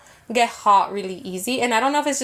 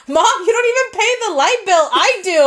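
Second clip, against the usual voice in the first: sister's mad retort; 7 semitones higher, 5 dB louder than usual. Retold or imitated. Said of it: "my sister's like".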